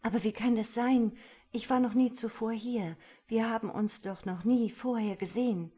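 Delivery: aufgeregt verwirrt. Acted. Verwirrte Aurora fragt aufgeregt.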